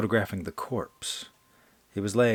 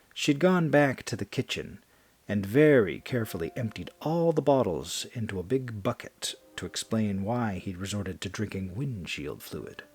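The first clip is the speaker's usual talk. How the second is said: ordinary delivery, nothing marked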